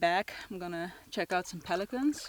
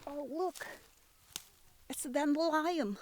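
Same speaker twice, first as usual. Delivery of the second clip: excited; 10 semitones higher than usual. Real, not acted. Spots a dandelion on a walk.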